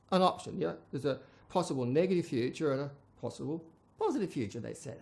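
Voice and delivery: usual speaking voice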